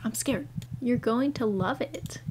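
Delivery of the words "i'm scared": ordinary talk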